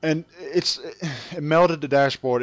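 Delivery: ordinary talk, nothing marked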